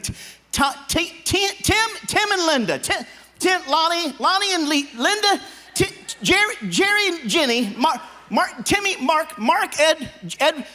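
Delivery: high, frantic stammering; frantic name fumbling. Acted. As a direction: scramble for the name.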